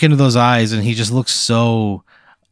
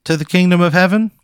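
nervous TV pitchman; 7 semitones higher than usual. Acted. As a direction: play an awkward local TV salesman. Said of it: "And he goes".

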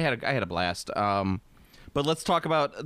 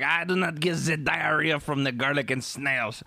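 put-on French accent; 3 semitones higher than usual. Mocking French Canadian.

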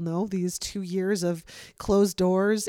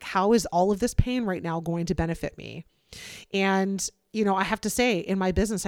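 usual speaking voice

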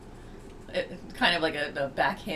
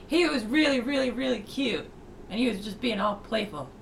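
excited; 6 semitones higher than usual. Real, not acted. Gushing about cute orca.

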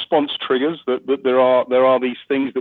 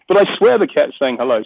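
normal talking voice

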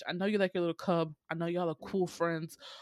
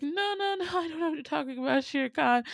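much higher, quick flustered denial; nervous snake denying; 10 semitones higher, faster than usual. Retold or imitated. Snake denying he ate anyone, high and flustered. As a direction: squirm out of the question. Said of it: "And Kaa's like".

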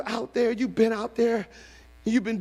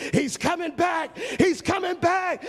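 higher, rising shout of joy; father's joyful shout; 10 semitones higher than usual. Acted. Father spotting his son coming home, shouting. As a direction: let the joy burst out.

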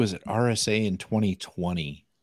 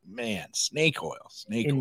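scoffing; 3 semitones higher, 4 dB quieter, slower than usual. Retold or imitated. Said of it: "I was like".

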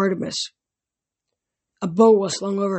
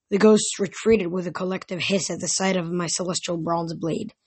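ordinary delivery, nothing marked

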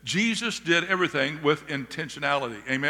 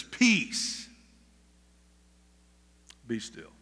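calm command; 6 semitones lower, 6 dB quieter, much slower than usual. Acted. Jesus calms the storm.

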